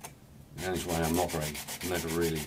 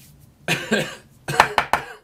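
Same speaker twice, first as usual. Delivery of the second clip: loud hacking cough; coughing fit; 11 dB louder than usual. Real, not acted. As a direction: cough hard from the dust. Coughs from dust.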